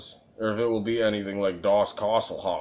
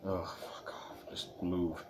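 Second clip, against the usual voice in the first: slow whispered annoyance; frustrated whisper; 3 semitones lower, 14 dB quieter, slower than usual. Real, not acted. Streamer whispering at a frozen game, annoyed.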